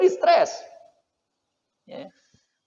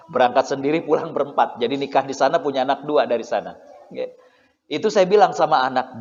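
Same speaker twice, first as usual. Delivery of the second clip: usual speaking voice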